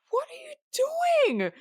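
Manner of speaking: imitating Susanna's horrified disbelief; high, drawn-out gasp of disbelief; shocked. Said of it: "And Susanna is like".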